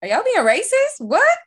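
high-pitched, loud disbelief; incredulous. Real, not acted. Friend questions the others' Mario Kart talk, incredulous. Mock shock at friends' answer.

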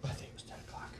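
voiceless, hushed correction; whispered aside. Real, not acted. Correcting his friend in a whisper. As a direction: murmur it under your breath.

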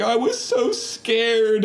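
high, drawn-out tearful wail; slow, whiny imitation of crying; mock crying. Imitating the scared woman, mock sobbing.

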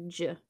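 J as a short "juh"; soft G sound. Shown as a demonstration. Explaining G H spelling to co-host, J as "juh". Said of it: "if I see G H together".

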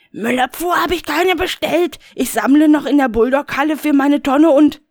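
high, indignant Rainer voice; a high, put-out grumble; Rainer, high and agitated. Acted. Rainer brushes off the caller, high and huffy.

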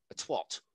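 "twat" pronounced "twot". Shown as a demonstration. Showing how America says it, "twat" as "twot". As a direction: round the A of "twat" into an O. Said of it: "pronounce an A as an O".

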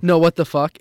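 play-acted loud bafflement; mock outraged disbelief. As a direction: protest loudly as if cheated. Imagined outburst when timer expires.

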